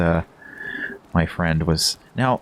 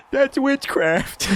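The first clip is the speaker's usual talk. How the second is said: high, drawn-out laughing cry; laughing squeal; much slower than usual. Real, not acted. Reacting to the spooked guards with a laughing squeal.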